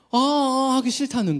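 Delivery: high mock whine of a child who won't tidy; whiny child. Little child refusing to tidy her Lego, whining.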